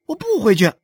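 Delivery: high, loud refusal; defiant child. Acted. A son refuses to leave his mother, defiant.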